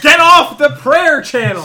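slow, loud comic yell; shouting. Pretend. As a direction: bellow it at a sibling on the phone. Yelling at a phone line.

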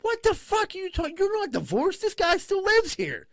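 very high, loud incredulous rant; high mock outrage at her; outraged disbelief. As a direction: squeal the disbelief. Retold thought, outraged disbelief.

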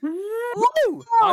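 high excited cheer; excited whoop. Real, not acted. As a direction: whoop with joy. Cheering at money.

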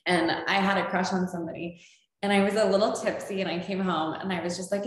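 normal talking voice

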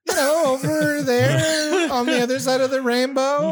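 mock Irish brogue, laughing; mock Irish accent. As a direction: ham up a leprechaun brogue. Mocking Irish stereotype answer.

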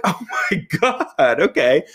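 high, slow Oh my God then okay; relieved surprise. Retold or imitated. Relieved shopper thanking the security guard, high and slow. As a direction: gasp it out with sudden relief.

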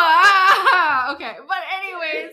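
high-pitched laughing talk. Real, not acted. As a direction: laugh through the words. Both laugh over swearing.

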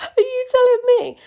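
high laughing disbelief; amused disbelief. Real, not acted. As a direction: laugh out the question. Mock disbelief at the plot.

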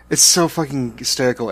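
ordinary talk

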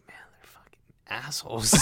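whispered private curse; under your breath. Shown as a demonstration. Showing how you curse difficult people, under the breath. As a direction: whisper it as if only thinking it. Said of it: "you kinda go under your breath".

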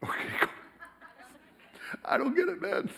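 high, soft, puzzled laugh; confused visitor. Retold or imitated. Museum tour guide imitating a baffled visitor, high puzzled laugh. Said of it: "And you're like".

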